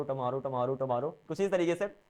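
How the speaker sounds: "टमारो" said with a short, neutral first vowel, tuh-MAH-ro; correct pronunciation. Shown as a demonstration. Teacher correcting "टुमारो" to "टमारो" for students, clear demo. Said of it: "इसकी जो correct pronunciation उसे कहते हैं टमारो".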